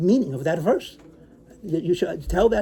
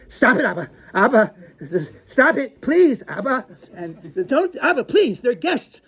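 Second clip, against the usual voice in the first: higher, louder embarrassed begging; imitating kids begging dad to stop; mock mortified children; pleading children; 5 semitones higher, 5 dB louder, slower than usual. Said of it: "my children were saying things like".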